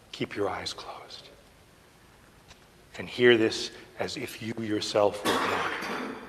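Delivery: very soft, low request; hushed, low and intimate; hushed guiding. Real, not acted. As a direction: lead them gently, almost whispering. Guiding listeners with eyes closed.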